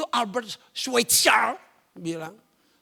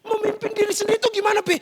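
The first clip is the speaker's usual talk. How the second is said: quick, high-pitched question; rapid childlike query, voice pitched up; puzzled child; 10 semitones higher, 4 dB louder, faster than usual. Acted. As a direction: fire it at daddy like an eager kid. Acted child questioning father.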